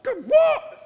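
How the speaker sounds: very high, loud rising what; hammed-up indignant squeal; mock outrage. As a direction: yelp it in disbelief. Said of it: "And we're like".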